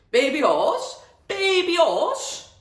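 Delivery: very high, very loud incredulous roar. A narrator's reading. Farmer repeating Tucky's words back, roaring incredulously. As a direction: bellow it in amused disbelief. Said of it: "Mister Reynolds roared with laughter".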